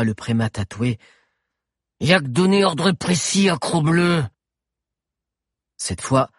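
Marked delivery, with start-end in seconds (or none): 2.0-4.2 slow, higher grating savage chief; drawn-out, pitched-up grating creature voice. A narrator's reading. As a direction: grate out halting broken speech. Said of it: "d'une voix grinçante".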